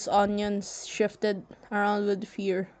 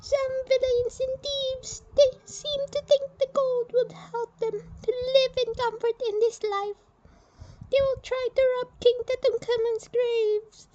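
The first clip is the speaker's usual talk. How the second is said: a much higher, firm general announcing the burial; stern general voice. Acted. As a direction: speak as a proud Egyptian general, high and set.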